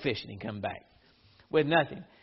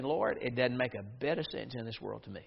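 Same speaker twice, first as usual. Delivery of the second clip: hushed aside; 10 semitones lower, 12 dB quieter than usual. Retold or imitated. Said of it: "Peter's way of saying".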